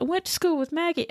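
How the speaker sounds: high-pitched mock bleat; Randall bleating. Captive claiming an old school friend, bleating. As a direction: plead it in a high little voice. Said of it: "Randall's bleating all this stuff".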